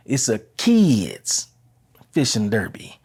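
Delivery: slow retold quote, "kids" drawn out and leaned on; stress on kids. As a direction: imitate the reverend leaning hard on "kids". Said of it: "The emphasis on the word kids".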